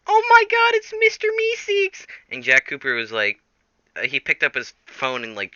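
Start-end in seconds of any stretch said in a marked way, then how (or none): 0.1-2.0 very high, loud excited squeal; squealing fans. Acted. The internet squeals with excitement over Meeseeks. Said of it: "the internet was like".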